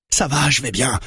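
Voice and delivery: high, tense insistence; hurried, high-pitched reassurance; strained reassurance. A narrator's reading. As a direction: insist you're fine, voice pushed high. Wounded Branco reassures panicking gunmen.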